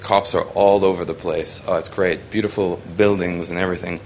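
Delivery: ordinary delivery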